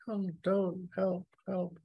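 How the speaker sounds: high, small imitation of a victim; mock cry for help. Reader mimics the woman on an empty street, mock pleading. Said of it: "She's going".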